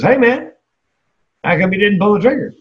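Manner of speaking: high, brightly curious mock friend voice; friend's eager question. Friend after the keynote asks, eagerly. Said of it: "he goes".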